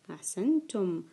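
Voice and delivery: usual speaking voice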